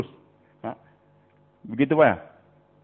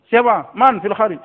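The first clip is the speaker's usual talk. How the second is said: louder, higher call through the door; mother calling out; 7 semitones higher, 10 dB louder than usual. Acted. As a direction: call out to whoever knocked. Mother answering a knock. (ibu berseru dari balik pintu)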